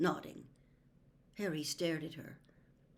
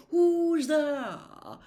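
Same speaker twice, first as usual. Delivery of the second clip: higher and louder call; 10 semitones higher, 9 dB louder than usual. A narrator's reading. Trelawney calling out to the unseen intruder, loud. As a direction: call into the dark room. She called out.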